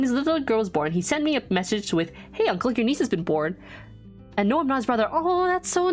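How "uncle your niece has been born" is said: usual speaking voice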